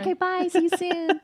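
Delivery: very high, sweet put-on goodbye; sweet phone sign-off. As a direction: end the client call in a sugary little voice. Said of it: "I played the role".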